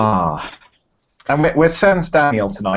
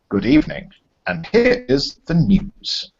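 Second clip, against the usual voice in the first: lightly put-on English newsreader accent; slow, measured English accent for the news opener; 3 semitones higher, slower than usual. Opens the show as a mild English newsreader. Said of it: "I was gonna do the English style".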